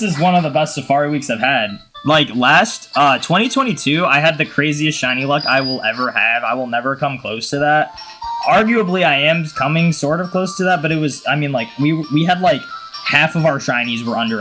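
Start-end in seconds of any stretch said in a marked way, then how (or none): none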